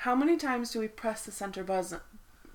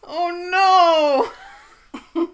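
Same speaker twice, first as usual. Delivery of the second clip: horrified laugh; 9 semitones higher, 13 dB louder than usual. Real, not acted. Dreading her own autocorrect.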